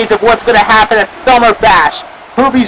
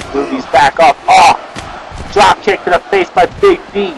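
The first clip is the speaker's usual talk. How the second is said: live ringside play-by-play, rising to shouts; wrestling commentary; 4 semitones lower, 4 dB quieter than usual. Pretend. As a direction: call the match like a wrestling announcer.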